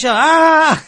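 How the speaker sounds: high, long "aah" cry; mock a scare attempt with a high yell; imitated shout. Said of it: "he started shouting".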